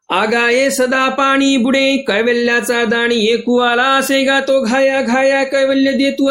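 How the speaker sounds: measured scriptural chanting; scripture recitation. Acted. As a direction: intone the old verse, stretching the line endings.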